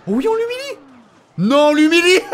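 gleeful gloating. Real, not acted. Gamer gloating as he destroys the rival tower, high gleeful glee. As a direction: relish humiliating him.

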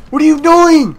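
high, exasperated yelling; frantic urging. Real, not acted. Gamer urging teammates to go in, frantic urging. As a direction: shout your teammates on.

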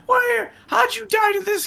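high startled shout; surprised shout. Real, not acted. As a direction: blurt it out in shock. Surprised at teammate dying.

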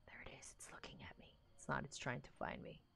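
hushed whisper, scared of being seen. Real, not acted. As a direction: whisper so the spider won't notice. Hiding from spider, whispering.

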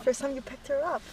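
ordinary talk, nothing marked

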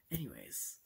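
ordinary delivery

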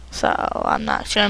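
ordinary talk

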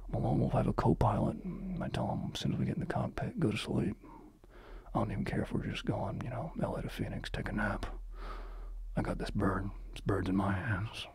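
soft, low lone-wolf captain; hushed cool pilot voice. Acted. Mock alpha pilot bragging to his co-pilot, hushed. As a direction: murmur like the coolest pilot alive. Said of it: "how fucking cool that sounded right there".

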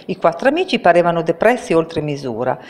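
ordinary delivery

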